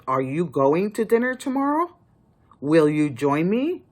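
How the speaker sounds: a genuine question with a rise at the end; real question tune. Shown as a demonstration. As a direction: ask it, lift the end. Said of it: "A question mark is like".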